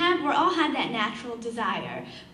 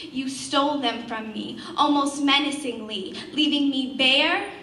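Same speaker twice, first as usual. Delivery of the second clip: accusing verse, swelling louder; intense spoken-word poem; 3 semitones higher, 6 dB louder than usual. Acted. Poet accuses the beloved of stealing from her, intense rising spoken word. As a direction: build the accusation line by line. Almost menacingly.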